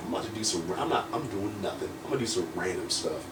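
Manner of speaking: low, soft aside; muttered self-talk. Retold or imitated. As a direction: mumble it to yourself, clueless. Retold clueless thought to himself.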